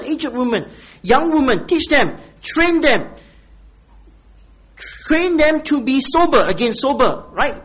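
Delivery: loud, high sermon delivery; fervent preaching. Acted. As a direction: preach it with full force. Preaching to the congregation.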